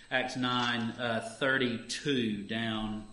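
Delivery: ordinary talk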